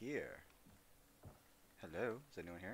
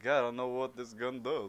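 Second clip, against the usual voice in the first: loud, higher mock complaint; mock exasperation; 5 semitones higher, 11 dB louder than usual. Gamer voicing confusion at a gun description, loud mock exasperation. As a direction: blurt it like a fed-up player. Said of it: "It's like".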